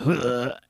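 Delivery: audible sigh into the mic. Shown as a demonstration. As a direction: let out a long sigh. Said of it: "just sigh into the microphone".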